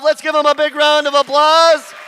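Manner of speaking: ordinary delivery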